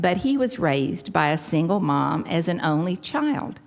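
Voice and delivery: ordinary talk, nothing marked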